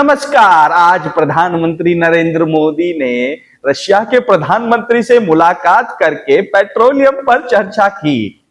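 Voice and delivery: high, loud dramatic news anchor; a newsreader with a punchy, high-pitched rhythm; different newsreader. Shown as a demonstration. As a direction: announce it like a showy TV anchor. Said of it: "अलग-अलग तरीके से present करते हैं".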